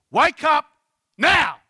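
very loud, high commanding shout; authoritarian shout. Retold or imitated. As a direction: shout it as a cross order. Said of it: "sounds very authoritarian".

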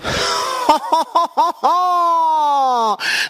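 high falling whoop of glee; wild whooping yell. Pretend. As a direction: let out a long triumphant whoop. Wild shout after battle cry.

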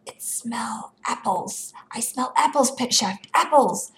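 hushed start building to high exclamation; excited discovery. A narrator's reading. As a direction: sniff out a find with rising excitement. Character excitedly smells hidden apples.

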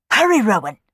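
sharp, loud bark at the maid; barked order. Acted. Housekeeper barking at the nursery maid, loud and sharp. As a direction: snap the order like a stern housekeeper. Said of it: "she barked".

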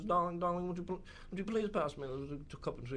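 a hint of a doting grandma asking for tea; mild mock of a fussy old lady; imitating grandma's speech. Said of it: "she always talks like this".

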